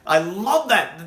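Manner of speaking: ordinary talk, nothing marked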